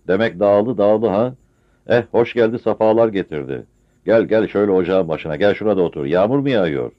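put-on loud, welcoming old host; hearty old villager. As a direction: boom out a warm welcome.

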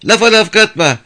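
high, loud, fast yelling; shouting girls. Acted. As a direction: yell over each other. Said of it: "hep birden bağırmaya başladılar". (hep bir ağızdan bağırarak)

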